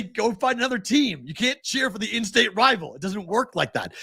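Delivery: high-pitched, emphatic scolding; exasperated self in argument. Retold or imitated. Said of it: "You did multiple voices".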